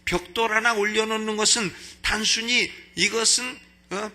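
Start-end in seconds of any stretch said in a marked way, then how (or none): none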